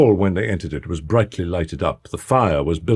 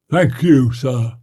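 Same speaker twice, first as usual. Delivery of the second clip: high, raised thanks with a hint of triumph; note of triumph; 7 semitones higher, 4 dB louder, slower than usual. A narrator's reading. Butler answering his master through the door, a touch triumphant. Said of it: "a note of something like triumph".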